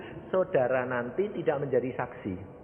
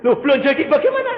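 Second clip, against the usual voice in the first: fast, loud, high-pitched retort; mock baffled challenge; 12 semitones higher, 10 dB louder, faster than usual. Complainer challenges pastor's answer, fast loud disbelief. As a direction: fire back the question loudly.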